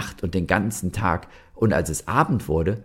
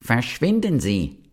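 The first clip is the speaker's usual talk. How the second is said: higher, hammed-up shout of Verschwinden; the toadstool yells again, pitched up; mock shout; 6 semitones higher than usual. A narrator's reading. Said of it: "schrie der Giftpilz noch einmal".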